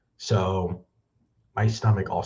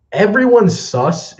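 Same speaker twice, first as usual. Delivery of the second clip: mocking; 11 semitones higher, 8 dB louder, slower than usual. Mocking the movie tagline.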